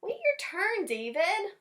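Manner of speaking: high, sharp teacher call; scolding teacher. Acted. Teacher catching David cutting the lunch line, high.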